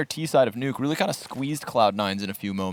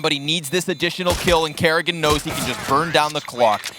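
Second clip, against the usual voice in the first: higher-pitched, excited round call; live esports play-by-play; 6 semitones higher, 4 dB louder than usual. Real, not acted. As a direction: call the clutch as it happens.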